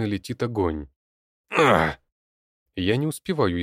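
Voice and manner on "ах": pained gasp. Acted. Hit by a sudden blast of fire.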